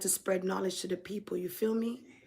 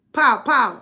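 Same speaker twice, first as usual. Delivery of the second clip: gunshot noises; 3 semitones higher, 11 dB louder than usual. Retold or imitated. Imitating gunshots on rewind.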